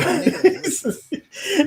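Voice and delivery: high-pitched fit of laughter; helpless laugh. Real, not acted. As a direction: crack up before you can speak. Cracked up at the fuzz.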